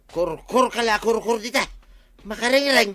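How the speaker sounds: high, loud kurkur call; chicken call. Acted. Calling the chickens in, high and loud. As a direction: call the birds home.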